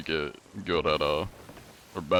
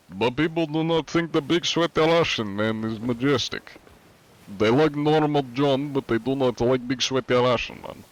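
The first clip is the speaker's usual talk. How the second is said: higher-pitched hammed-up Russian accent, big sweaty Russian man; 5 semitones higher than usual. Streamer answers chat as his big sweaty Russian man, in a higher Russian accent. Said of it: "when I do the Russian accent".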